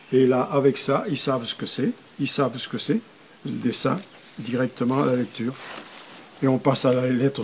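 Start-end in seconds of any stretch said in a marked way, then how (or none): none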